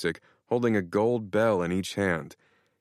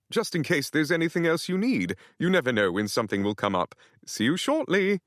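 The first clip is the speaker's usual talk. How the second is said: lightly British, a touch higher and coy; hint of a cheeky British houseboy; sly British butler; 8 semitones higher than usual. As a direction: tease it politely in a British lilt. Nigel the houseboy speaking.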